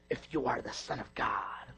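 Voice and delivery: low, slow devil's voice; slow, sinister low tempter; satanic voice. Acted. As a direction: tempt him like Satan himself. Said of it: "That's my satanic voice".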